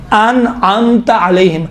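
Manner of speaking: انعمت slowly, a clean hamza then a throaty ain; hamza and ain distinct. Shown as a demonstration. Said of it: "عین اور حمزے کا تلفظ میں".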